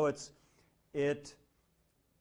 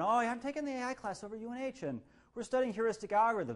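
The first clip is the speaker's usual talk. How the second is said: proud student; 8 semitones higher than usual. Retold or imitated. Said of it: "say to someone".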